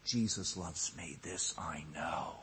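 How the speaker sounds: soft, low and slowed right down; hushed low recital after a climax; tender hush. Real, not acted. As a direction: drop to a quiet, intimate assurance.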